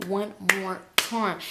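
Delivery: slow whisper with deliberate pauses; hushed, measured exasperation; whispered warning. Retold or imitated. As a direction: whisper it slowly as a threat to yourself. Private threat, whispered.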